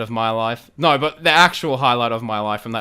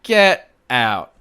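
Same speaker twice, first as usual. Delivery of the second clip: emphatic, louder "get out"; astonished disbelief; 8 dB louder than usual. Retold or imitated. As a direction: blurt it out in happy disbelief. Retells astonished reaction to backstage invite.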